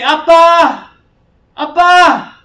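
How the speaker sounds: high, full-voiced desperate yell; loud cry out. Lost Korean boy calling for his father, loud cry. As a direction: scream it out for your father. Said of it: "in a loud voice".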